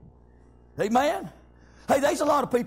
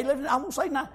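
normal talking voice

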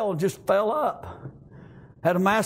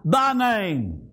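raised, rhythmic preacher cadence with gasped "uh"; full-force preaching; 5 semitones higher than usual. Real, not acted. Preacher retelling Jesus's prayer to the Father, full-force preaching. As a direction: preach it out, stretching the key words.

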